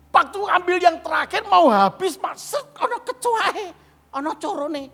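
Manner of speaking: high, loud shocked cry; preacher reliving finding the roach. Retold or imitated. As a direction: gasp out the discovery. Shocked discovery of cockroach.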